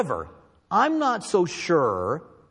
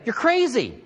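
dismissive; 8 semitones higher than usual. Retold or imitated. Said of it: "they would say".

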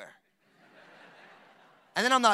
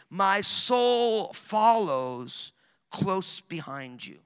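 slow emphatic reading; 6 dB louder, much slower than usual. Real, not acted. Preacher reading verse eight to the congregation, very slow. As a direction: stretch every word, leaning on soul.

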